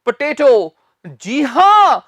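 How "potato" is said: पोटैटो with a long "tay" middle; correct pronunciation. Shown as a demonstration. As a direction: say पोटैटो as "puh-TAY-toh". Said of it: "इसका सही accent है".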